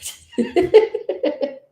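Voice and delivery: loud high laugh. Real, not acted. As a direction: burst out laughing. Laughs at telling news people to move.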